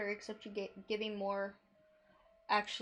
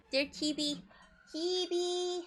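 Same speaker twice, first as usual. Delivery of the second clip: high, cutesy mascot voice; squeaky little-helper voice; chippy voice; 9 semitones higher, slower than usual. Pretend. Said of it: "I can do chippy".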